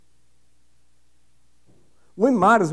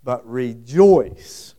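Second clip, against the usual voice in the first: low, slow, weighty but rejoice; slow emphatic quote; 6 semitones lower, 5 dB louder, much slower than usual. Real, not acted. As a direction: lean hard on rejoice. Preacher quoting key verse.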